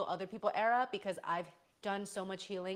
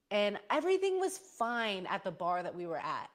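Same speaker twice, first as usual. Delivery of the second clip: ordinary delivery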